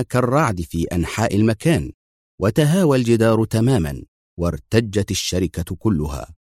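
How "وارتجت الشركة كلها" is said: ordinary delivery, nothing marked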